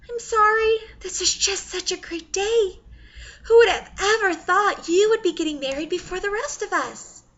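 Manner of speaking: high, crying through happy tears; teary. Acted. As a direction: sob a little through joy. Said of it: "a tear runs down her cheek".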